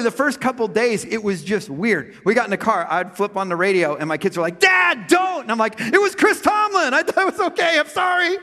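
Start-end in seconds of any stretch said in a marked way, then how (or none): 4.6-5.4 high, loud protest; kids' shout. Retold or imitated. The kids shout at Dad for switching on the radio, high and loud. 5.8-8.4 much higher, apologetic mock plea with a laugh; mock high protest. Dad defends the [name] song to his kids in a high mock plea.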